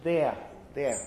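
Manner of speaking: "there" in Southern Standard British, no final r; non-rhotic RP "there" with an open vowel; RP accent. Shown as a demonstration. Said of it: "the way to do it in R P or in Southern Standard British English".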